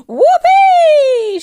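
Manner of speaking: put-on screechy old woman's gleeful whoop; very high, full-force yell; whooping shout. A narrator's reading. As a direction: let rip with a long, wild whoop. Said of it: "she shouted, finding her voice at last".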